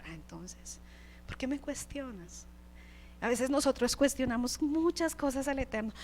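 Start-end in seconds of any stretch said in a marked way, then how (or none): 0.0-2.4 much softer, slow, gently pointed; soft divine rebuke. Acted. God gently confronting Job, soft slow rebuke. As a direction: ask it quietly, letting the question sink in.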